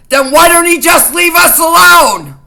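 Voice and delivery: loud, high furious outburst; angry shout. Real, not acted. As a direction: yell it out in real anger. Furious rant about cult leader.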